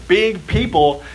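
ordinary talk

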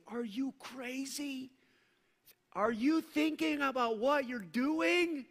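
high, slow pleading friend; worried friend. Retold or imitated. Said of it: "friend of Zacchaeus ... pull on his sleeve".

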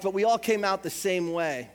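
normal talking voice

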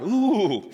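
high, swooping mock "ooh"; mock shudder. Speaker reacting to Joan Crawford's name, mock shudder. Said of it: "some of you are shuddering".

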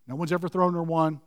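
ordinary talk, nothing marked

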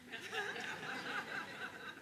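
bright burst of laughter; high laugh. Real, not acted. Preacher laughing at his own joke. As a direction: laugh at the punchline.

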